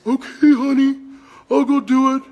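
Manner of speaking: mock Ahab obeying his wife; meek husband. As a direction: give in sweetly like a henpecked husband. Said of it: "I'm not like an Ahab".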